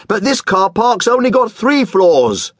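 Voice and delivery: high whiny complaint; moaning. Acted. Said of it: "the man moaned".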